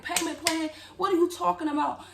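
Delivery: incredulous question. Retold or imitated. Retelling disbelieving reply to staff.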